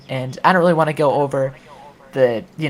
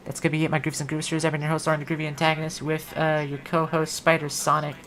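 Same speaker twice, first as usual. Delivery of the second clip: quick, soft host sign-off; fast quiet show-host outro; 6 dB quieter than usual. Acted. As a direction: rattle off the outro briskly. Stream sign-off announcer.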